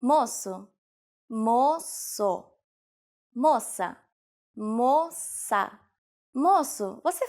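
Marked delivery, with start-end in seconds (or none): 1.3-2.4 "moço" stretched into two slow syllables; slow teaching repetition. Shown as a demonstration. Teacher repeats word slowly. 4.6-5.7 "moça" stretched into two slow syllables; slow teaching repetition. Shown as a demonstration. Teacher repeats word slowly.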